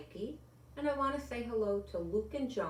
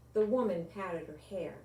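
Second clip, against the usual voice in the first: ordinary delivery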